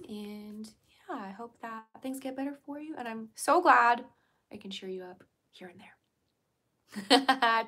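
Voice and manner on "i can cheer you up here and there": slow whisper. Real, not acted. Whispered, slowed.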